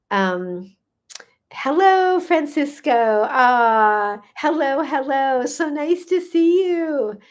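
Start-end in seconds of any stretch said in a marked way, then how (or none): 1.5-7.2 high, drawn-out happy hello; delighted greeting. Real, not acted. Live host greeting returning viewer Francisco, delighted.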